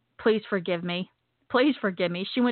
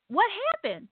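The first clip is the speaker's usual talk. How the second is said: high-pitched startled question; retold bewilderment; 10 semitones higher than usual. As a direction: act out being utterly baffled. Bewildered reply to shouting friend.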